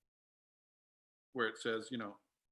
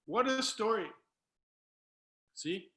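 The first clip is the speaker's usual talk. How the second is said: choked up, pitch lifted with emotion; recovering from real tears; tearful; 9 semitones higher, 5 dB louder than usual. Real, not acted. Speaker apologising to listeners for crying, tearful. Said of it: "Sorry that I'm crying".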